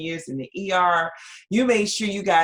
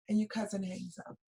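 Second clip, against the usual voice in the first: ordinary talk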